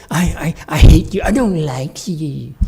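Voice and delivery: high, loud mock outburst of hate; hammed-up petulant "I hate you"; mocking ordinary people. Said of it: "You say".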